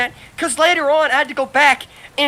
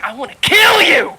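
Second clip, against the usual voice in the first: mock furious thought; 8 semitones higher, 5 dB louder than usual. Acted inner thought at supervisor.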